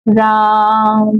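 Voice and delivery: राम called with the vowel held very long; calling out. Shown as a demonstration. Teacher calls राम with a long stretched vowel. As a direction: call राम from afar, drawing it out slowly. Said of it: "किसी को भी आप आवाज अगर लगाते हैं".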